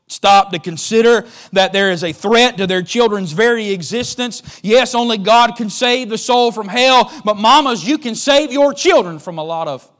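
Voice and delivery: preaching. Acted. Preacher warning the mamas, building preaching. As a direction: press the warning on the mothers.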